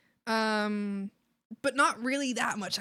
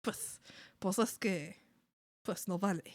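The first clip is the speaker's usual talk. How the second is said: put-on mom's Spaniard accent; 2 semitones lower, 7 dB quieter than usual. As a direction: quietly imitate mom doing a Spaniard accent. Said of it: "imitate my mom doing a Spaniard accent".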